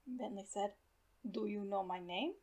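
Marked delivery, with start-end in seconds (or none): 1.3-2.3 put-on old doctor asking in German accent; thick accent. A narrator's reading. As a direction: question the boy with a German accent. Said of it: "in his thick accent".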